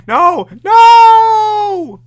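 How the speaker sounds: high piercing shout; screaming no. Pretend. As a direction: shriek it out loud. Screaming no in play.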